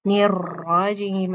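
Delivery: slow الرجیم with an over-rolled r; wrong recitation. Shown as a demonstration. Teacher repeats the mistaken الرجیم for students. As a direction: drag الرجیم and over-trill the r. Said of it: "ایسے نہیں پڑھنا اس کو".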